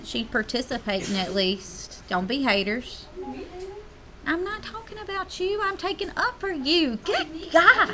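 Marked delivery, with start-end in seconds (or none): 4.2-7.9 mock defensive squeal. Real, not acted. Teacher defends student, high far voice.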